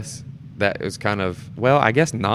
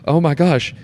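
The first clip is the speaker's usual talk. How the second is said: shocked panic; 8 semitones higher, 6 dB louder than usual. Retold or imitated. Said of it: "I'm making a face like".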